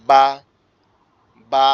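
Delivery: বা from closed lips popping open; lip-closed ba demo; lips pressed for ba. Shown as a demonstration. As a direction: press the lips together, then release into বা. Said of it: "এরকমভাবে".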